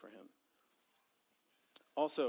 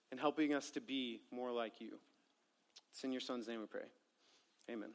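hushed, earnest prayer voice; quiet opening prayer before the reading; 3 semitones lower, 3 dB quieter than usual. Real, not acted. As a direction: pray softly over the group.

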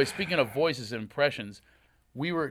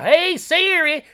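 a slow, much higher, louder Southern call; heavy Southern accent; 12 dB louder, much slower than usual. Retold or imitated. Host trying heavy Southern on Siri, slow and high. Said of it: "I tried heavy Southern".

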